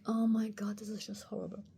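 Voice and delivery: hushed, breath-only retold dismay; horrified whisper. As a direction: drop into a whisper of mock horror. Reliving her distressed reaction.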